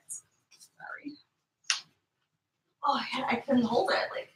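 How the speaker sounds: whispered, breathless apology after rushing back; hushed whisper. Real, not acted. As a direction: whisper it, a little embarrassed. Returning to stream, hushed apology.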